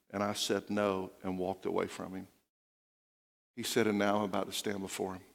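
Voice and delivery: soft and low, full of regret; quietly remorseful old man; regretful confession. Retold or imitated. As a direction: confess a lifetime of saying no. Dying man's regretful confession.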